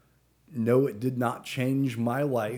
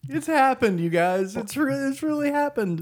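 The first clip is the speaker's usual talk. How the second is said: thrilled big announcement; bursting with excitement; excited; 14 semitones higher, 4 dB louder than usual. Real, not acted. Big reveal to the others.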